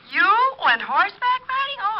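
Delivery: can't believe her ears; amazed. Acted. Girlfriend amazed he rode horse.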